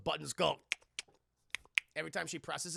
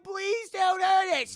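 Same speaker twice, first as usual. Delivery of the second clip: high, slow, loud begging; hammed-up frightened plea; pleading girl; 11 dB louder, much slower than usual. Girl begs the spirit.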